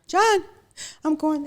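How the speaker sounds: reliving the panic, crying out to him; panicked call. Retold or imitated. Wife calling husband from hotel room in a panic, frantic high plea.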